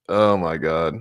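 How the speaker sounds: ordinary talk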